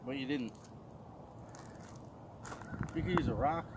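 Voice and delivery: normal talking voice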